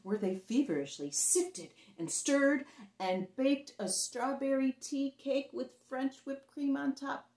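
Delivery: ordinary delivery, nothing marked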